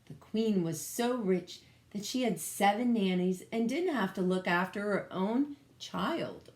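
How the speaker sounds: ordinary delivery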